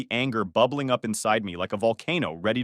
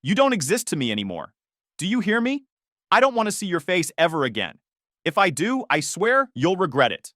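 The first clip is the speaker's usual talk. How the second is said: raised, menacing voice; angry threat; 6 semitones higher, 4 dB louder than usual. Retold or imitated. Son warning his ex-friend never to return, angry.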